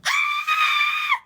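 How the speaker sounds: long, shrieking frustrated squeal; very high squeal. Real, not acted. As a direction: let out a sustained high-pitched squeal. Overwhelmed while retelling confrontation.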